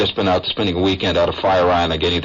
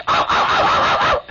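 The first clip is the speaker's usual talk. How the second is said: corgi yapping. Retold or imitated. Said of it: "he goes".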